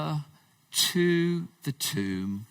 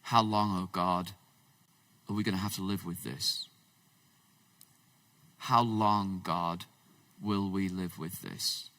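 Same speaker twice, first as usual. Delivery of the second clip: softer, hushed plea to God; murmured weary lament, slowing at the start; quiet lament; 6 semitones lower, 6 dB quieter than usual. Retold or imitated. Preacher voicing a worshipper lamenting to God, hushed and weary. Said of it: "A lament is where you're saying to God".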